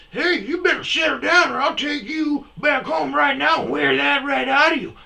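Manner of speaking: high falsetto shout, playful threat; mock scolding woman. Wife threatens to take ornery husband home, high mock scolding shout. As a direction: holler it like an exaggerated old wife.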